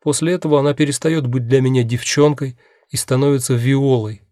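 plain reading voice

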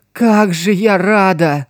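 overjoyed, near tears; happy tears. Acted. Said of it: "расплакался от счастья". (со слезами радости)

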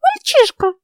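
high squeaky alarmed cry. A narrator's reading. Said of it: "писклявым голоском вскричала она".

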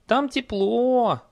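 high, slow, drawn-out horse voice; coaxing horse. A narrator's reading. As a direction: stretch it out high, tempting the rider. Horse coaxing her rider. (высокий протяжный голос лошади)

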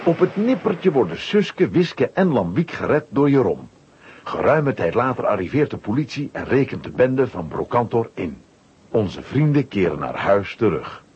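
ordinary talk

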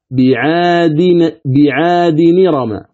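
"بعاد نرم" drawn out as bi-'aadi-ni-rama; demonstrated pronunciation. Shown as a demonstration. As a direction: slowly link "بعاد نرم" with no glottal stop. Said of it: "ولفظها".

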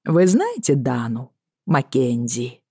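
lilting Irish captain, slow; Irish accent. Acted. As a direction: ask it in a light Irish brogue. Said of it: "с певучим ирландским акцентом".

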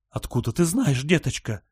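high-pitched, distraught challenge; demanding. A narrator's reading. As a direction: demand an answer from the child. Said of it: "потребовала ответа Большая Барбара".

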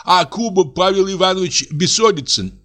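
high whimpering voice of a man who thinks he is dying; frightened old clerk. A narrator's reading. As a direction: tremble through it, half crying. Reads the poisoned man.

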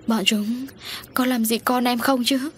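anxious, pitch rising high with worry; frightened mother. A narrator's reading. Mother asks police, afraid for her child. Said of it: "U Duyên lo sợ".

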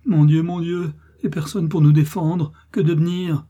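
high, loud wail of despair; terrified cry. A narrator's reading. Mother with no one to defend her, frightened wailing. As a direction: cry out helplessly to God. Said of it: "s'écrie Marthe, plus morte que vive".

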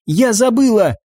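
high, alarmed outburst; horrified cry. A narrator's reading. Eva realises she forgot the detonation, horrified. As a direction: cry out in sudden realisation, pitch leaping up.

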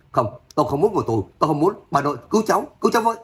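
narrated frantic grandson begging to be saved; panicked screaming. A narrator's reading. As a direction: plead in terror, building to a high-pitched cry. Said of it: "gào thét đến ghê người".

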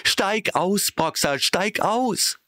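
high, slow, urgent calling; chorus call. A narrator's reading. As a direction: shout after the cart. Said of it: "stimmten in den Ruf ein". (hoher, drängender Ruf)

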